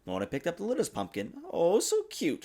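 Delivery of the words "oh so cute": high, delighted coo; charmed little cry. A narrator's reading. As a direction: gush over something small. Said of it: "she said".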